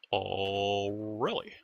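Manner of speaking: feigned amazement at the sales pitch; hammed-up oh really; mock surprise.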